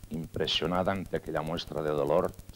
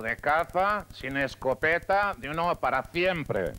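voz aguda y lenta de personaje; 8 semitones higher, 4 dB louder, slower than usual. Acted. Diálogo padre e hijo.

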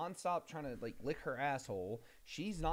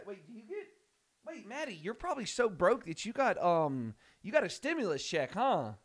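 excited teasing; 7 semitones higher, 7 dB louder than usual. Real, not acted. Teasing a viewer about money.

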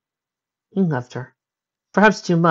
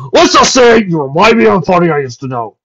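evasive dragon voice; 6 semitones higher, 11 dB louder than usual. Acted. Spike's evasive reply.

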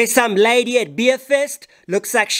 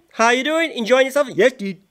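ordinary delivery

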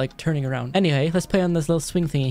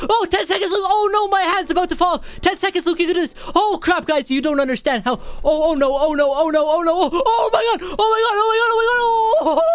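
frantic panic; 12 semitones higher than usual. Real, not acted. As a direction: squeal through the last desperate seconds. Final seconds, hand about failing.

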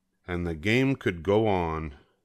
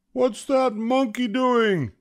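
high, projected yell; umpire's yell; 3 dB louder than usual. A narrator's reading. Umpire spotting a monkey on the field, yelling. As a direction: yell it across the ball field. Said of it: "yelled the umpire".

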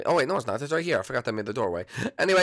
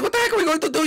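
panicked; 11 semitones higher, much faster than usual. Acted. Panicked Luigi.

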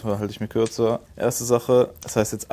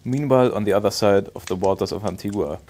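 even storyteller read; story narration; 2 semitones lower, 3 dB quieter than usual. Acted. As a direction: read the tale aloud to viewers. Reads English story narration.

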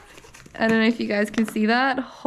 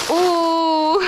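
excited ooh; 6 semitones higher, 5 dB louder than usual. Real, not acted. Reacting to dream car part.

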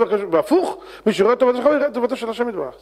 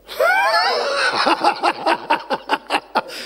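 high laughing burst. Real, not acted. Preacher bursts out laughing at the punchline, high peal. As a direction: crack up at your own joke.

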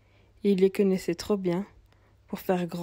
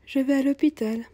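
put-on young mother; 6 semitones higher, 5 dB louder than usual. Young mother voiced in story.

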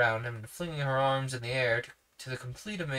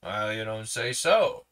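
impudent, sarcastic; 2 semitones lower, 6 dB louder than usual. Acted. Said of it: "half impudently, half sarcastically".

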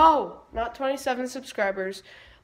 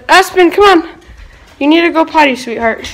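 loud, high call outside; calling the dog; 5 semitones higher, 12 dB louder than usual. Real, not acted.

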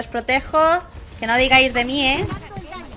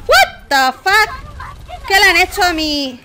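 much higher, slow drawn-out outrage; stunned disbelief; 7 semitones higher, slower than usual. Real, not acted. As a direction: stretch it out in utter disbelief. Teammate dies unexpectedly.

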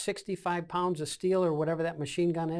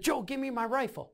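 higher-pitched insistent demand, not shouted; urgent request, mildly acted; retold demand; 7 semitones higher than usual. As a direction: re-enact demanding your rifle back. Retold demand for his rifle.

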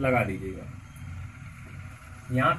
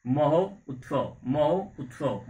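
महो उत्सव said slowly, split into महो and उत्सव; slow, split at the join; slower than usual. Shown as a demonstration. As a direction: pull महो उत्सव apart with a small gap. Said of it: "इसको आराम से बोलिए".

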